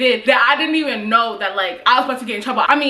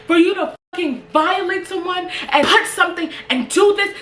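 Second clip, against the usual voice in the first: mock furious principal; 7 semitones higher than usual. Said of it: "He was mad as hell".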